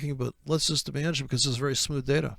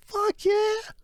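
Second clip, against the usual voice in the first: very high, squeaky triumphant yes; cocky trader. Acted. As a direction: yelp it proudly.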